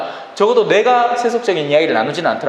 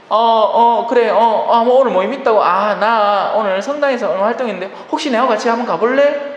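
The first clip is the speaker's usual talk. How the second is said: higher, louder, breezy friendly offer; casual invitation; 6 semitones higher, 3 dB louder than usual. Retold or imitated. Believer casually inviting a friend to church, breezy and bright. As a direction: toss it out lightly to a friend.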